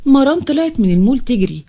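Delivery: ordinary delivery, nothing marked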